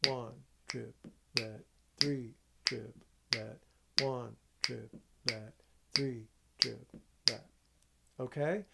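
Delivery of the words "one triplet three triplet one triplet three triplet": slow, stressing one and three; accented counting. Shown as a demonstration. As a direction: lean on one and three, stretch them out. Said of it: "Silence your left hand".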